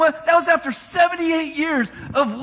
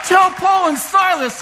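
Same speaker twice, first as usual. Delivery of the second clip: slow, loud, higher proclamation; preacher shouting a challenge; ringing shout; 4 semitones higher, 4 dB louder than usual. Real, not acted. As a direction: call it out to the rafters. Preacher's rising exhortation.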